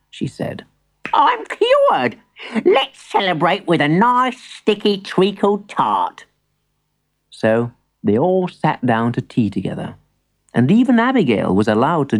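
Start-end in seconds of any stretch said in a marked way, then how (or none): 1.1-6.3 put-on high, celebratory old-granny voice; overjoyed storybook granny; delighted granny. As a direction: announce the good news high as a granny. Granny rejoices at her cure.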